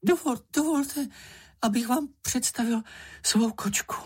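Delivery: high, quavering character voice; trembling voice. A narrator's reading. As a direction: stammer a shaky, high-pitched introduction. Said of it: "řekl Čenich rozechvělým hlasem".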